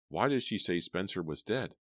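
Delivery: plain reading voice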